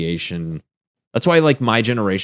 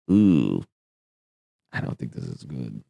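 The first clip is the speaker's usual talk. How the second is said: worried mutter; 9 semitones lower, 6 dB quieter than usual. Retold or imitated. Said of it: "I'm like".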